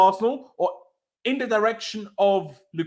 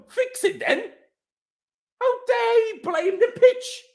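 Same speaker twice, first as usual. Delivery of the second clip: much higher, indignant cry; outraged squeal; 14 semitones higher, slower than usual. Real, not acted. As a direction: squeak it in disbelief. Outraged at pitch excuse.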